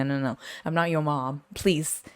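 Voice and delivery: usual speaking voice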